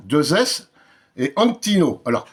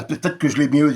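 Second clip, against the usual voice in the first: usual speaking voice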